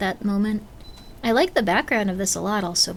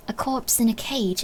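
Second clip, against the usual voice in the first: usual speaking voice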